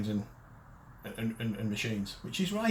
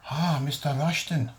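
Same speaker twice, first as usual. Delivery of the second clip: drawn-out fake Eastern accent; slow salesman greeting in a caricature Eastern accent; mock Eastern accent; 2 semitones higher than usual. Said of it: "Quite a good accent".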